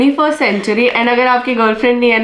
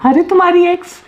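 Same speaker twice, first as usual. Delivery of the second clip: high put-on girl's voice; man doing a woman's voice; 8 semitones higher than usual. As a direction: pose as a girl on the phone. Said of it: "लड़के की आवाज, लड़की की आवाज में". (लड़की की आवाज़ में बोलना)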